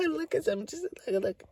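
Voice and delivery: very high laughing imitation; high, gleeful mock laughter. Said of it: "it was like she was laughing".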